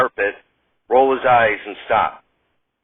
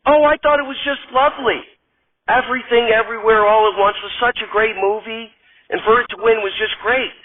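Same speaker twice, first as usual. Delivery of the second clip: put-on much higher, chirpy, girlish voice; sweet Gilda; 8 semitones higher than usual. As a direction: gush like a delighted starlet. Radner gushes.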